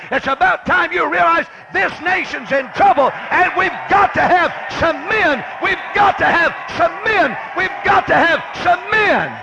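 high, shouted pulpit exhortation; full-force preaching. Real, not acted. Preacher challenging the teenagers to live for God, shouting. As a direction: shout it at the congregation, driving each phrase home.

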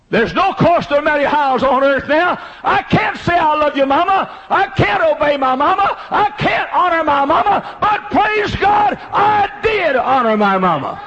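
high, loud shouted climax, slowing to the end; preaching at full force. Acted. Preacher shouting praise over his mother's memory, fiery and slow. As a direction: cry it out and drag the last praise.

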